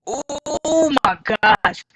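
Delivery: strained, slow groaning gasp; sore muscles. Real, not acted. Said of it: "I'm so sore".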